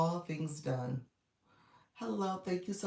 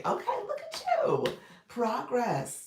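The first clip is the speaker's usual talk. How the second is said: much higher, loud delighted praise; excited praise; 9 semitones higher, 6 dB louder than usual. Real, not acted. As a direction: gush over their progress. Praising a viewer's progress.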